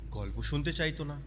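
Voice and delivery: ordinary delivery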